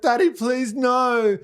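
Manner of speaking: put-on high pleading little girl; pleading child voice. Dad voicing his daughter begging, high child voice. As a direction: beg like a scared child. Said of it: "she's like".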